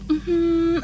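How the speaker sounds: high, clipped-off mock hum; a curt high hum of brush-off; nurse's dismissive hum, mocked high. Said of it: "she was just like".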